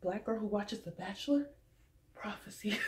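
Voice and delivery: hushed aside. Retold or imitated. To viewers, a hushed knowing aside. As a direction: lean in and murmur it knowingly. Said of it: "I was just like".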